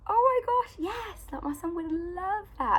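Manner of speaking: high, bright burst of delight, then slowing; thrilled. Retold or imitated. Mum reliving her joy at finding golf for her son, high and thrilled. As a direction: gush with joy at the find.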